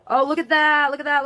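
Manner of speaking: high, loud gushing over a picture; thrilled, pointing at the screen; excited. Real, not acted. Vlogger gushing to viewers over capsule photos, excited.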